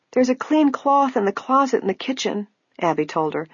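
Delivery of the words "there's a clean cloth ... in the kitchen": put-on high, frail old woman voice. A narrator's reading. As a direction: speak like a feeble old lady.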